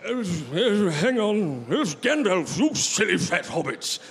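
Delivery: high, loud, wavering wizard voice; croaky old wizard scolding; old man voice. Shown as a demonstration. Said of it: "Can you give us a blast of your old man voice?".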